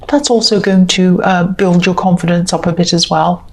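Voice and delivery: normal talking voice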